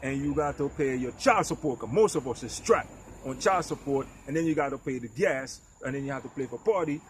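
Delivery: ordinary talk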